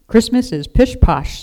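loud gruff scoff. A narrator's reading. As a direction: brush it off gruffly and loudly. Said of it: "said Jonathan gruffly".

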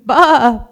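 high, loud frightened sheep cry; a startled lamb's bleat; sudden scared bleat; alarmed bleat. A narrator's reading. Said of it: "Daisy bleated in alarm".

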